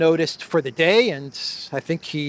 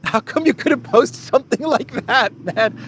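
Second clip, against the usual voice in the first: incredulous; 7 semitones higher than usual. Real, not acted. Incredulous at the post.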